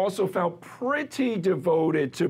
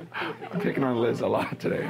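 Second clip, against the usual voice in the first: laughing; 5 semitones lower than usual. Real, not acted. Laughing while teasing Liz.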